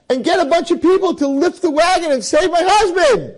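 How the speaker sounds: high, frantic plea for help; panicked scream. Acted. A wife begs a passerby to save her husband, frantic and panicked. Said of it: "there's a lady screaming".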